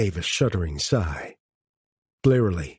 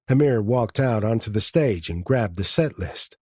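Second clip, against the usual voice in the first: ordinary delivery, nothing marked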